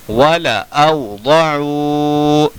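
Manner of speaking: वला औ, दौ chanted with the last vowel held long; slow Quran-class demonstration; measured recitation. Shown as a demonstration. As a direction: recite evenly, stretching the end of दौ.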